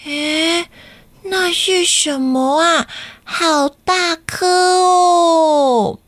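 put-on high, drawn-out wondering child voice; a small boy spotting something amazing; curious little boy. Kakai finds a big egg in the jungle, amazed. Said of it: "就听到卡卡伊说".

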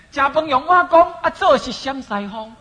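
大声朗诵台语骂人的俏皮话; hammed-up recitation of a rhyming insult; loud mock curse. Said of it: "reciting a mock curse". (老师大声念台语骂人俗语)

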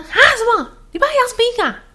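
high, loud mock-shocked mother; mom's shocked outburst. Retelling mom hearing her son wants an eagle, shocked and loud.

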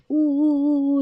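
high storybook boo-hoo; mock crying. A narrator's reading. Bear coming over in tears, mock crying. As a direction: play at sobbing for a child listener. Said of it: "小修哭了过来".

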